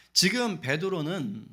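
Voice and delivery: ordinary talk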